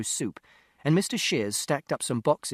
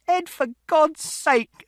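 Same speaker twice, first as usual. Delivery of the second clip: very high, loud and slow; desperate shriek; 7 dB louder, much slower than usual. A narrator's reading. Mother begging father to stop, desperate shriek. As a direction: cry out to stop him. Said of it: "And Mother shouted".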